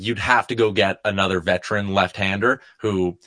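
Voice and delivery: usual speaking voice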